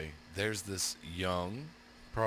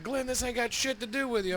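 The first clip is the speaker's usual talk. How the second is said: retold yelling; 12 semitones higher than usual. Retold yelling match between neighbors.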